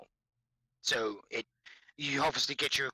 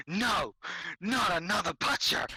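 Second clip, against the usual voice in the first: mock dread; 5 semitones higher than usual. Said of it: "and I'm like".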